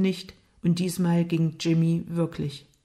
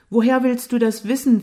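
higher, louder scornful question; angry challenge; 5 semitones higher, 7 dB louder than usual. A narrator's reading. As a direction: go on the attack. Jimmy fährt Lukas im Streit an. (gereizt und angriffslustig)

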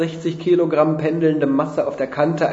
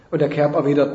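ordinary delivery

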